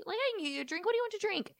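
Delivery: high, exaggerated offer of a drink; mock solicitous. Podcaster playing Jax pushing a drink on James, mock eager.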